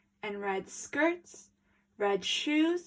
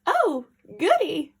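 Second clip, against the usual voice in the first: pleased granny; 6 semitones higher, 7 dB louder than usual. Acted.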